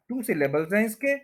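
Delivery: ordinary talk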